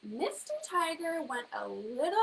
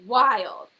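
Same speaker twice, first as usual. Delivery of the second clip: wild said much louder; punched word; 2 semitones lower, 12 dB louder than usual. A narrator's reading. Storyteller landing on wild loudly for the class. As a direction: hit wild hard.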